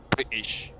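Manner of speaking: "British" with the T dropped; demonstrating "British" without its T; British-style pronunciation. Shown as a demonstration. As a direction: leave out the T in "British". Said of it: "they don't pronounce it".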